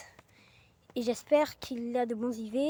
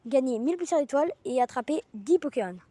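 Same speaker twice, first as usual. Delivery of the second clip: ordinary talk, nothing marked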